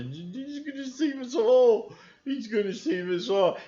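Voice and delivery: high, gushing put-on voice; mocking believer. Mocking fans who think Trump saves all, high gushing. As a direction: swoon over your savior.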